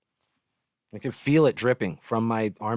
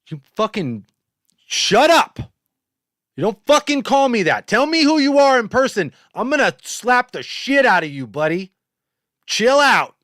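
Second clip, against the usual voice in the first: much higher and louder, real rage at a heckler; furious yelling; 11 semitones higher, 7 dB louder than usual. Real, not acted. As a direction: shout him down, really angry. Yelling at a rude chatter.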